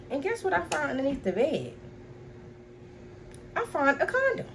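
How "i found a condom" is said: very high-pitched, incredulous squeak; shocked reveal. Retold or imitated. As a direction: drop the bombshell in a high voice.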